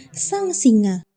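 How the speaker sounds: plain reading voice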